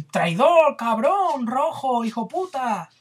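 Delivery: high, loud furious insults; hammed-up mob rage; mock furious insults. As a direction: spit out insults while beating. Mob beating victim, retold.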